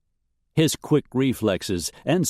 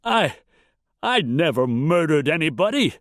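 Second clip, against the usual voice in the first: high, frightened denial; stammered, high-pitched fear; panicked gasp; 7 semitones higher than usual. A narrator's reading. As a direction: gasp out a desperate protest. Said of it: "Wolford gasped".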